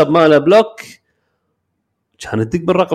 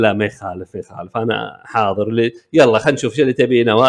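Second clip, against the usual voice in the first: calm humouring; 8 semitones lower, 3 dB quieter than usual. Retold or imitated. Abu Muhsin calmly humours her.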